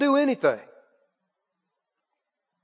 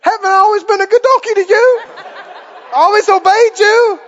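very high, loud pleading donkey; hammed-up indignant donkey voice; wronged donkey; 10 semitones higher, 7 dB louder than usual. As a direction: plead your case, comically hurt. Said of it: "The donkey says".

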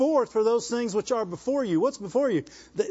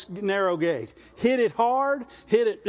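ordinary delivery

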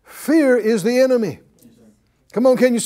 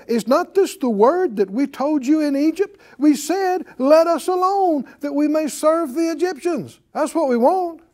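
complaining; 7 semitones higher than usual. Retold or imitated. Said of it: "they talk like this".

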